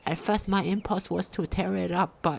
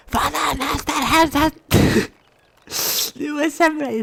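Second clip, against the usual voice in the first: laughing; 6 semitones higher, 7 dB louder than usual. Real, not acted. Bursts out laughing while reading.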